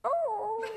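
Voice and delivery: high, drawn-out dying-dog howl; long mock howl, far above speaking pitch; imitating dog howl. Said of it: "And Harvey goes".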